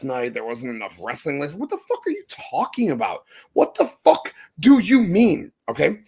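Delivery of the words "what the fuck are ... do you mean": exasperated outburst. Real, not acted. Ranting at online wrestling critics.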